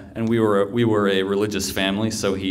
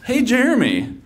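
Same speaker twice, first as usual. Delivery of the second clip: higher-pitched, eager hail; calling a friend; 13 semitones higher than usual. Retold or imitated. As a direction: get a friend's attention, pitched up. Calls next friend in retold scene.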